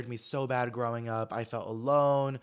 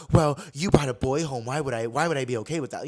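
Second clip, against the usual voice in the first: a hint of a disapproving mother, a touch louder; skeptical mom; 5 dB louder than usual. Son imitating his skeptical mom about the boyfriend, mock scoff. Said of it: "kinda homophobia vibes and kinda being like".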